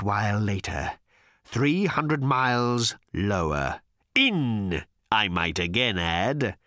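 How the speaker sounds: slow, sardonic monster voice; bitter creature voice. Acted. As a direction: drag out each grievance sourly. Agrajag's bitter tale.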